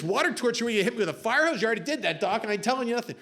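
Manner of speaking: hammed-up indignant tough guy; macho American spy. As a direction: scoff fast at the threat. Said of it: "this macho American spy says".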